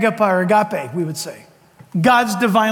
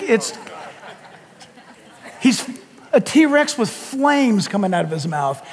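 normal talking voice

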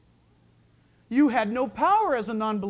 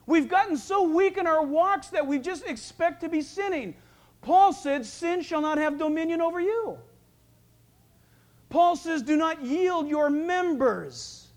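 raised, driving pulpit voice; preaching at full force; 5 semitones higher, 4 dB louder than usual. Acted. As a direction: press the point on the congregation.

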